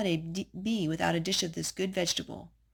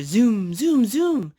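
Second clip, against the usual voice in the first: zoom; 4 semitones higher, 10 dB louder, much slower than usual. Acted. Zooming race cars in book.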